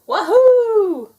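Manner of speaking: very high, loud joyful whoop; excited whoop. A narrator's reading. Duck thrilled to be driving, loud high whoop. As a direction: whoop with pure glee.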